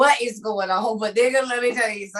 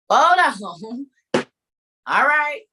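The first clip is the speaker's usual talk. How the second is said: ordinary delivery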